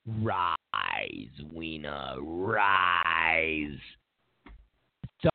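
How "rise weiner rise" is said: low, slow Darth Sidious rasp; Emperor impression. Emperor commanding Vader, deep and menacing. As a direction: intone it like the evil Emperor. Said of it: "That's Anthony Weiner".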